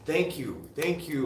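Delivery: high, loud joyful thank you; bursting with gratitude; excited thanks. Real, not acted. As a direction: ecstatic repeated thanks.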